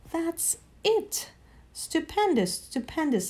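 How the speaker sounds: put-on high, gleeful old-man voice; excited Uncle Andrew. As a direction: crow with delight over the new world.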